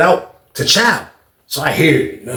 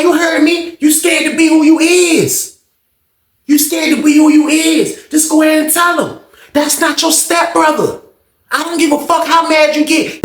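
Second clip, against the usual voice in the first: expose him at the top of your voice; retold taunting; 10 semitones higher than usual. The boy exposing Sean to the whole block, high jeering.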